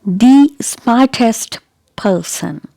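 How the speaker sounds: slow, with "the" stretched to stressed "thee"; emphatic the. Shown as a demonstration. Teacher shows a person is important, long stressed "thee". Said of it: "when you want to emphasize something".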